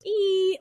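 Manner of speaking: high, loud imitation of escaping air; balloon squeal. Shown as a demonstration. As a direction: squeal like a balloon releasing pressure. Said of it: "when you hit a balloon and it goes".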